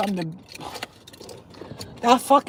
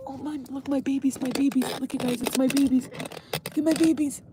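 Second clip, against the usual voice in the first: cooing; 4 semitones higher than usual. Real, not acted. Cat lover showing viewers the babies, cooing. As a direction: gush over beloved little animals.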